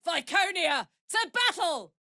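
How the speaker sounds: high ringing call to arms; rally the ally forward; battle cry. Acted. Calling Viconia into battle, a high rallying shout.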